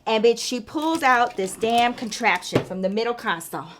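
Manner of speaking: ordinary delivery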